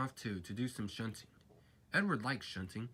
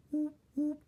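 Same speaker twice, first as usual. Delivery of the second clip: engine whistle imitation; 5 dB louder than usual. A narrator's reading. Gordon's whistle.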